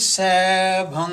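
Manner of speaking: "Sabhang" slow, held on a humming "ng"; "Sabhang" ending in a long pure nasal. Shown as a demonstration. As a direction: stretch "Sabhang" and never release a G. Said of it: "There's no G there".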